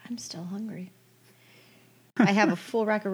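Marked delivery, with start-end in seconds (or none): 0.1-0.9 whispering. Real, not acted. Whispered aside.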